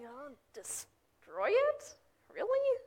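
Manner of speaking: drawn-out question leaping high at the end; acted incredulity. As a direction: imitate baffled disbelief, stretching the words. Leaders incredulous at Jesus's claim.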